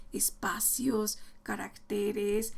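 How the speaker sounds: usual speaking voice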